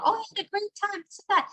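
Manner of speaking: parroting cheery outsiders; light mock-bright voice of others; mock cheerful report. Imitating people praising her son.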